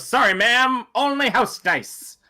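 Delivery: high, drawn-out host call to a customer; game host. Acted. Host refusing a customer's dice, high drawn-out patter. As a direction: put on a high, slow dice-table host. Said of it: "the host turns around and goes".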